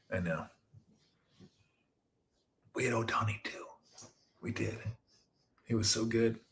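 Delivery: whispered, soft confession. Real, not acted. An Angels fan admitting a loss to viewers, in a whisper. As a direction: whisper it like a guilty secret.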